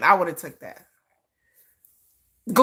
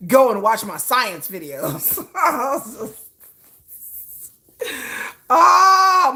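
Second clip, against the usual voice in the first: laughing; 2 semitones higher, 4 dB louder, much slower than usual. Real, not acted. Laughing at her own joke.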